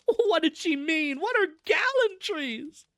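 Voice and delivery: high, loud, slow bewildered cry; scandalised wail. A narrator's reading. Cousin puzzled by gallantries, scandalised wail. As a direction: draw it out in shrieky dismay. Distraught relative asks about shocking word.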